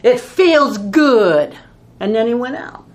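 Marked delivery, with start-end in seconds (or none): 0.0-1.5 louder and very slow, savouring the anger; drawn-out, louder mock-angry relish. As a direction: lean into it like a man gloating over his grudge. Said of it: "he turned around and he said".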